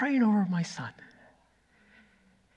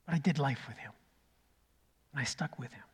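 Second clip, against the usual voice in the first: soft fading; 7 semitones lower, 13 dB quieter, faster than usual. Real, not acted. Tender closing of the story.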